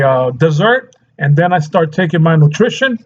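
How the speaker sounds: ordinary talk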